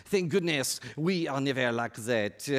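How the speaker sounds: slow, hammed-up French accent; awful French accent. Preacher voicing the congregation's smug thought, awful French accent. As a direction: put on a bad mock French accent. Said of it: "in your awful French accent".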